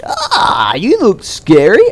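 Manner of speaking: mock scared householder. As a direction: play a neighbour faking being scared.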